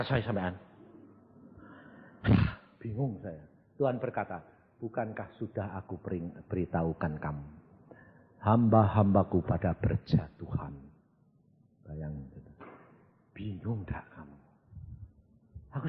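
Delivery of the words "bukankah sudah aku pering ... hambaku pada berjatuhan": low, soft divine voice; God's voice. Acted. Preacher voicing God to congregation, low soft lament. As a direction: speak it softly, as God lamenting.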